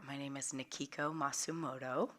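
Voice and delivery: usual speaking voice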